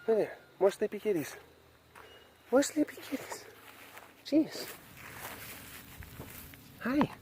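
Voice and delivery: soft, high cooing to the cats; tender pet talk; cooing at kitties. Real, not acted.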